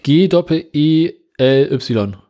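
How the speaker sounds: slow letter-by-letter spelling. Shown as a demonstration. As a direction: spell each letter slowly and clearly. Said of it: "Geely, wie schreibt man das nochmal?".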